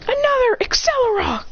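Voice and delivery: very high attack call; battle command. Acted. Rival repeats her attack.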